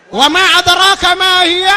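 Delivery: high, loud and fast Quranic question; loud rhetorical verse. Acted. Preacher challenging the congregation, high loud fast. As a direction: fire the verse out at them.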